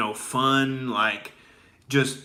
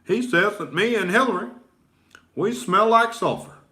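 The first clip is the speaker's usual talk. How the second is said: higher mock Obama voice; imitating Obama; 5 semitones higher than usual. Obama mocking the radio host, higher imitation. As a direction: do a light send-up of Obama's measured delivery. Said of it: "He's like".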